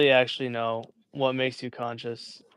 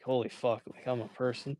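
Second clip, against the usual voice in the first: awed hushed; 12 dB quieter than usual. Retold or imitated. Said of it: "been like".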